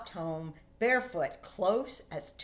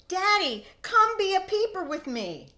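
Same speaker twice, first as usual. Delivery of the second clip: higher, louder call to daddy; child shouting; 11 semitones higher, 7 dB louder than usual. Acted. Boy calling his father to hop along, shouting. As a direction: call out to him across the room. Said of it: "Then he shouted".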